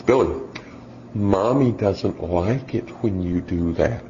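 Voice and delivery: slow, soft, syrupy mommy voice imitating a parenting-book mother; mock sweet mom. Preacher imitating a soft-spoken mom at Walmart. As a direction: gently scold the child like a mom who read the articles. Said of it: "And she says".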